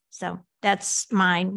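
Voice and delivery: normal talking voice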